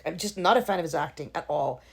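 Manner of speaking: usual speaking voice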